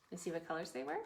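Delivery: ordinary talk, nothing marked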